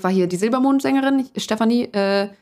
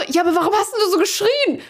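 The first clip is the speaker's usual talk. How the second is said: confused parents; 10 semitones higher than usual. Retold or imitated. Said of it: "Und die so".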